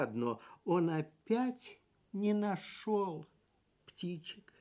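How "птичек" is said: plain reading voice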